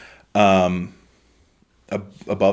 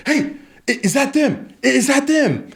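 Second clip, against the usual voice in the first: much higher, eager crowd voice calling out; a voice in the crowd spotting the heroes, high and eager; high excited villager. Acted. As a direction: squeak it out like an excited townsperson. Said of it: "you all hear a voice say".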